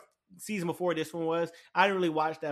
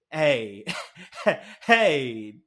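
loud hey, a breathy laugh, then hey again; can't contain the excitement; laughing hype; 3 semitones lower, 8 dB louder than usual. Real, not acted. Still hyped, laughing.

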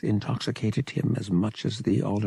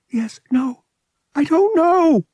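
high, loud breaking outburst; distraught girl, high and loud; near tears outburst; 7 dB louder than usual. Acted. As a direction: burst out on the verge of tears. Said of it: "she burst out, on the verge of tears".

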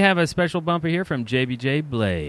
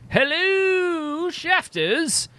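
character voice; 7 semitones higher, much slower than usual. Acted. Reading listener message in voice.

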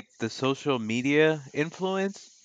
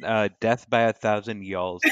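ordinary talk, nothing marked

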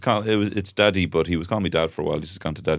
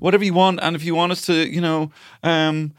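mock eager offer; 10 semitones higher than usual. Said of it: "podcast host offering listeners anything".

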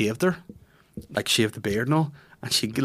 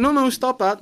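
flustered scolding; 13 semitones higher, 4 dB louder than usual. Retold or imitated. Voices mother hushing child.

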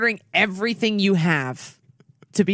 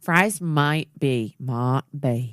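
slow dramatic; 2 semitones lower, much slower than usual. Pretend.

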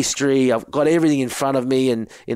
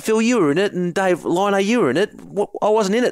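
indignant protest; 8 semitones higher than usual. Retold or imitated. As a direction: insist you're the one left out, voice up high. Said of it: "I said".

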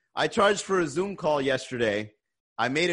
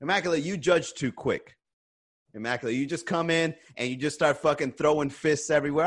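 usual speaking voice

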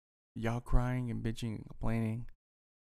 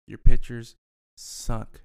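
much louder, slow jeering shout; angry rant; 14 dB louder, slower than usual. Real, not acted. Taunting Dodgers fans about their pitching, loud slow jeer. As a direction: hammer each word at them.

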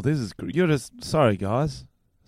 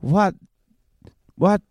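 high, slow Texas southern belle drawl; coy Southern belle greeting a stranger, pitched higher; 5 semitones higher than usual. Pretend. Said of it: "do a Southern Belle in Texas".

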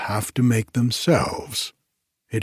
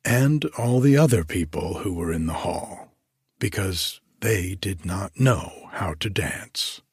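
usual speaking voice

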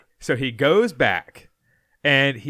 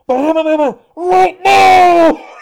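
shrill, much higher and much louder yell; screaming into a walkie-talkie; 11 dB louder, slower than usual. Retold or imitated. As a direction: scream the order at full force. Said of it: "like, yelling".

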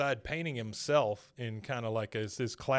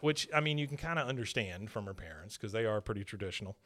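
usual speaking voice

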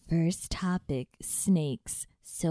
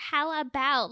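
pitched-up patronizing suggestion; condescending parent; 6 semitones higher than usual. Retold or imitated. Mimicking parent who tiptoes around criticism, condescending. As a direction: mimic a parent's tactful meddling. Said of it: "It's so condescending".

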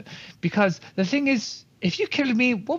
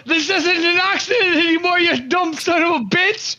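loud, higher shouted insult; yelling insult; 8 semitones higher, 3 dB louder than usual. Acted. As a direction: yell it back while fleeing. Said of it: "I yell".

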